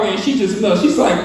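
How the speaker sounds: ordinary delivery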